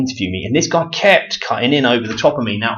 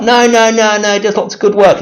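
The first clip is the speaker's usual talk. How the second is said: high, louder mimicry of stubborn defenders; mock protesting; 11 semitones higher, 5 dB louder than usual. Followers defending an exposed man, mock protest. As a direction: send up their denial in a raised voice.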